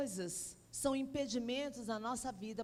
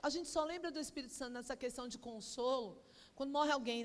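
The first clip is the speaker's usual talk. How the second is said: usual speaking voice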